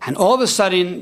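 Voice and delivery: ordinary talk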